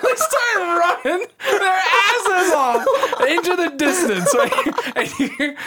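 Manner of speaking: high, loud, laughing through the words; real laughter. Real, not acted. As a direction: barely get it out for laughing. Laughing while telling story.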